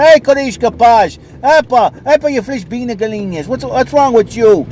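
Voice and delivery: high, loud hammed-up Portuguese accent; imitating a Portuguese cop scolding surfers, loud Portuguese accent; mock Portuguese cop. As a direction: play a flustered Portuguese cop with a heavy Portuguese accent. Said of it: "some crazy Portuguese cop or something goes".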